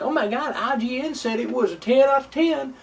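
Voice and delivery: high gushing mock voice; mocking normie gamer. Mocking normies who trust review scores, high gushing mock. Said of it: "they're like".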